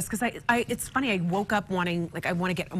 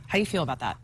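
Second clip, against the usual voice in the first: much lower, teasing repeat; 9 semitones lower, faster than usual. Pretend. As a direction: drop the voice low and tease. Teasing repeated question to host.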